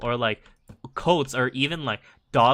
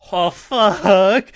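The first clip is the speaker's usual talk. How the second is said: mock dismay; 7 semitones higher, 13 dB louder than usual. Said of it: "Second one".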